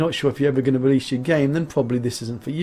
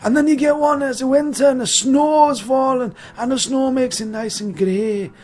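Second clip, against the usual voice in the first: loud, higher-pitched put-on Scottish voice; mock Scottish accent; 5 semitones higher, 5 dB louder than usual. Reviewer showing off the Scots Pine, hammed-up Scottish accent. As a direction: ham up a Scottish storyteller.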